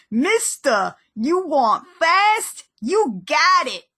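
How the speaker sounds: bad Brooklyn cabbie accent, slow and loud. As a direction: ham up a thick Brooklyn accent. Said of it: "doing the worst Brooklyn accent".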